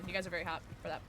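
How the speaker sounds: usual speaking voice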